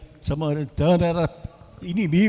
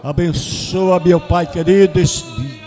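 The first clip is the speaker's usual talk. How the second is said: louder, fervent prayer voice; closing prayer; 6 dB louder than usual. Acted. Pastor praying aloud for the congregation, fervent and louder. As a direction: pray over the church with rising intensity.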